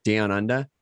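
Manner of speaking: brief Australian accent. Shown as a demonstration. Co-host showing the one phrase they can manage, Australian accent. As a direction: say it with an Australian twang. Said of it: "in an Australian accent".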